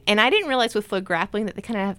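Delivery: ordinary talk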